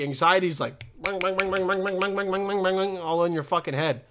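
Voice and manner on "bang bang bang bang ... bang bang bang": rapid run of mimicked banging; imitated anxiety banging. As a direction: rattle it off like pounding inside your head. Imitates anxiety hammering in head.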